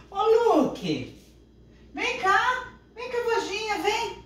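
high, loud call to the dog. Real, not acted. Chamando o cachorro. (chamando o cachorro bem alto e agudo)

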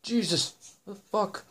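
loud high shriek after a jump scare; scared horror game streamer. Real, not acted. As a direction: yell out in real fright. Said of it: "Fucking jump scare me".

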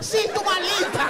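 high, loud, drawn-out mock Spanish shout; funcionária exasperada. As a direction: imitate the exasperated clerk shouting back. Funcionária exasperada confirma a mala. (imitação aguda e gritada da funcionária)

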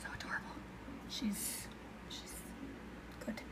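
whispering. Real, not acted. Gushing over a game character.

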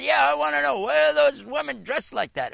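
high, loud imitation of a pushy questioner; mock-harsh woman repeating her demand. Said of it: "It was a very harsh question".

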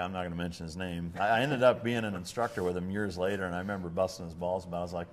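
ordinary talk

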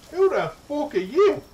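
loud, high-pitched shout; high, alarmed outburst; startled challenge. Real, not acted. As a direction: shout it at a sudden intruder. Startled by a stranger in game.

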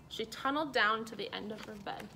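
ordinary delivery, nothing marked

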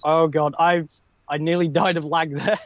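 usual speaking voice

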